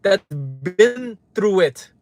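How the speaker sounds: real heated outburst, voice pushed up; angry rant. Real, not acted. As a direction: let the anger spill out at them. Ranting at doubters on stream.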